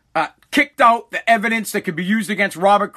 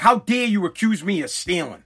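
ordinary talk

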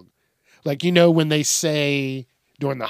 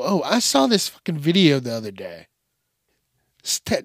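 ordinary talk, nothing marked

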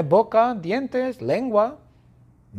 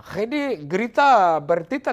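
ordinary delivery, nothing marked

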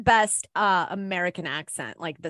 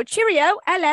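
high, chirpy mock British accent; 9 semitones higher than usual. Teasing people who mimic Brits, high mock British accent. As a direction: caricature a cheery Brit. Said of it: "with a British accent".

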